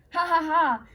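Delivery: taunting fox laughter; mocking fox laugh. A narrator's reading. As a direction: laugh at them like a sly fox. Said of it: "laughed the foxes".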